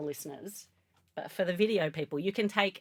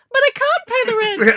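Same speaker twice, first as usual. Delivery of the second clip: very high, loud wailing damsel; damsel in distress; 15 dB louder than usual. Pretend. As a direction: shriek like a melodrama heroine. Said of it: "bow in your hair, and you go".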